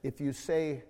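low, hushed voice, not a full whisper; soft confidential undertone; retold whisper. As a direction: quietly coach a small child. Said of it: "I whispered and said".

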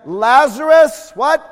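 loud, drawn-out call of a name; commanding call. Acted. Jesus calling Lazarus from the tomb, loud and commanding. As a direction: call him out of the grave.

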